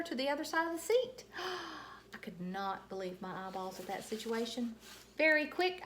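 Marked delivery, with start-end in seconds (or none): 1.3-2.1 sharp gasp of surprise; shocked gasp. A narrator's reading. Junie B gasping when her doll is grabbed. Reaction to Dolores taken.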